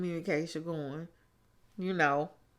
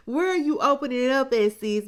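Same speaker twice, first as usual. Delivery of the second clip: higher and louder, fast and challenging; sassy callout; 6 semitones higher, 7 dB louder, faster than usual. Real, not acted. Vlogger challenging Kirsten on screen, loud and sassy. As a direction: call her out, sis, like you're not buying it.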